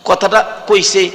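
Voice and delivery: ordinary delivery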